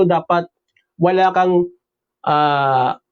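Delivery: ordinary delivery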